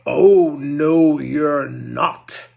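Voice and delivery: loud roar of no; the troll roars his refusal. Acted. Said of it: "roared the troll".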